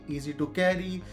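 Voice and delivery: ordinary talk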